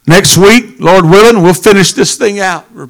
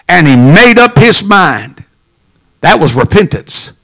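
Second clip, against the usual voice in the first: ordinary talk, nothing marked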